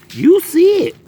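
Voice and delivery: high, loud squeal of glee; excited squeal. Real, not acted. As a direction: squeal it in delight. Repeats line, much higher and louder.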